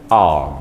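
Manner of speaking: arm with slightly lengthened A; long A in arm. Shown as a demonstration. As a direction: hold the A in arm, not short. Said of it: "bacaannya agak panjang sedikit".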